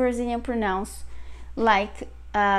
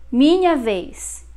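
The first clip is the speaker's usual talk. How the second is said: ordinary delivery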